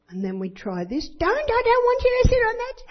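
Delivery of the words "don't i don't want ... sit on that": put-on high, loud shrill child's yell; little girl screaming. Little girl yelling at the visiting guests, a high child's scream. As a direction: shriek it like a bossy little girl.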